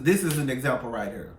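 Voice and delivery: ordinary delivery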